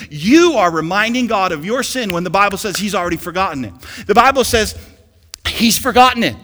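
loud, high-pitched preacher's cry; full-force preaching. Real, not acted. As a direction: preach it with mounting fervor, punching the key words.

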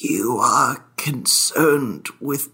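slow, whispered lament rising to a high crying plea; whispering ghost. Acted. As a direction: breathe it out, then wail the need.

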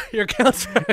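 laughing echo. Real, not acted. Echoing the co-host's punchline, laughing. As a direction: repeat it helplessly amused.

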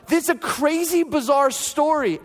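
high-pitched, emphatic marveling; wonder-struck retelling; retold amazement. Marvels at his own unlikely story.

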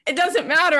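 ordinary delivery

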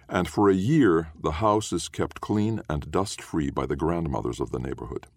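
usual speaking voice

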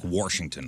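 "Washington" as "Warshington", R after the a; added R. Shown as a demonstration. Host showing Missouri speech, "Washington" as "Warshington". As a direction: slip an R into "Washington": "Warsh-ington". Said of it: "they throw an R in somewhere".

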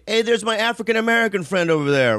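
lightly put-on hollering to the crowd; mock rally call-out. Mimics a politician at a rally.

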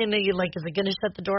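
usual speaking voice

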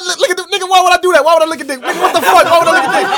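high, loud mock-outraged yelling; incredulous shouted protest, played for laughs; retold screaming disbelief. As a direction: imitate a friend screaming in disbelief. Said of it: "he just keeps screaming about me".

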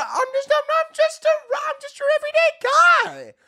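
very high squeak of mock normality; squeaky mock voice. Comic sarcastically insisting he's an everyday guy, very high squeak. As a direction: squeak it like a cartoon.

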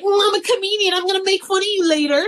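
high, teasing mock threat; sing-song tease aimed at the stepkid; a lightly voiced mom teasing back; playful threat. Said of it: "But I'm like".

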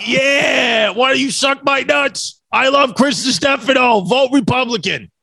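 high, slow Staten Island holler; hammed-up Staten Island loudmouth; Staten Island accent. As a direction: shout it like a caricature Staten Islander. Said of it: "Give me an accent".

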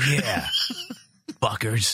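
mock-macho grunt; put-on manly voice; terse hammed-up tough guy; deep voice. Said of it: "let's just get a really deep voice".

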